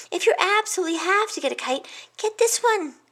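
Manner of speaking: a nervous little hedgehog listing past disasters; fretful little hedgehog. A narrator's reading. Hedgehog friend warning the pig about his decisions, high fretful voice.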